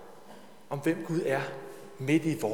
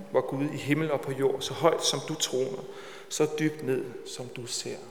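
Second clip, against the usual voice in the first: calm, even prayer voice, fading softer; 3 dB quieter than usual. Acted. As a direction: pray on behalf of the congregation. Leads the congregation in prayer. (rolig bøn for menigheden)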